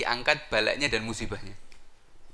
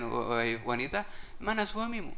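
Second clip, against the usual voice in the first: sly disguised iblis; 5 dB quieter than usual. Acted. Disguised iblis addresses the wife.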